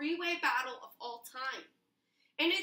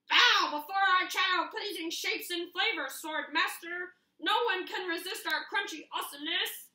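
high, louder, puffed-up monster voice; boastful nugget dinosaurs; 6 semitones higher, 5 dB louder than usual. Acted. Frozen dinosaur nuggets taunt Scissors, hammed-up boasting. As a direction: boast like silly food monsters.

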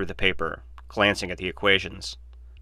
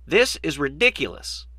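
put-on higher, indignant old man's voice; angry; 8 semitones higher than usual. A narrator's reading. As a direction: dismiss it angrily, higher pitch. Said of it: "Hammond stood angrily".

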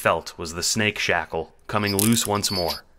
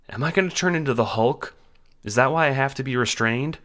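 put-on high, eager child's voice; excited child; 7 semitones higher than usual. A narrator's reading. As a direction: ask it with wide-eyed excitement, pitched well up. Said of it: "asked Cal excitedly".

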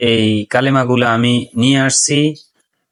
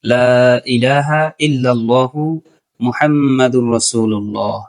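slow, careful Arabic recitation; slower than usual. Shown as a demonstration. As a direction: recite it again slowly for learners. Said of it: "আমি আবার বলে দিচ্ছি". (আবার ধীরে তিলাওয়াত)

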